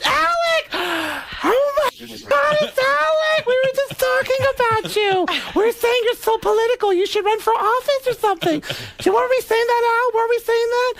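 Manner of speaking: a starstruck fan who oozes delight. Retold or imitated. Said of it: "He did [name]".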